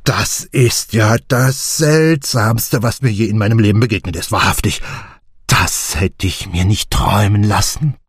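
a hint of a fussy, thrilled gentleman; excited old writer. A narrator's reading. Clancy marvelling at the dart, lightly voiced excitement.

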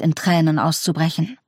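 plain reading voice

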